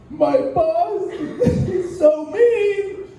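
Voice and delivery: high, laughing, slow self-pitying wail; high tearful whine; mock crying whine. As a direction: whimper about your boss like a sad kid. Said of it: "You're like".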